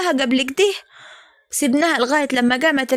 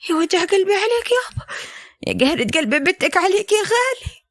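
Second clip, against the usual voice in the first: higher, keening lament for a dead father; mourning wail; 5 semitones higher than usual. Acted. Daughter lamenting her father on the phone, keening high.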